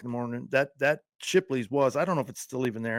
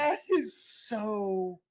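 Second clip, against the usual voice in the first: mock tearful; 8 semitones higher, much slower than usual. Mock emotional reaction to clip.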